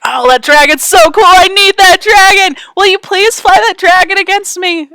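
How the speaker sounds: high, loud gushing fan voice; mock excited gushing. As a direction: gush over the dragon. Imitating excited dragon fans.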